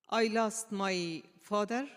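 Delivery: ordinary delivery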